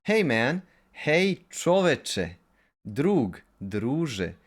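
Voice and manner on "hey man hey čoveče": raised, high hail to a man; calling out. Shown as a demonstration. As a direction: call him over from across the street.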